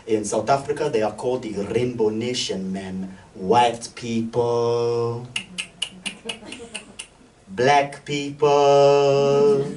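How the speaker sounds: solemn, dragged-out pronouncement, very slow at the end; Uber driver Trimore. Retold or imitated. Comedian voicing his Uber driver Trimore explaining the Rainbow Nation, very slow. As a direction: spell out each word as if deeply profound. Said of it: "He goes".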